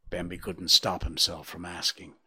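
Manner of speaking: plain reading voice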